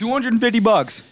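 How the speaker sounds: high disbelieving shout of surprise; can't believe the price; shocked. Real, not acted.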